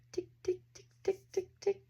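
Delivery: high, clipped tick-tock imitation like a game-show clock; ticking clock noise. Mimicking the Price is Right clock, high ticking.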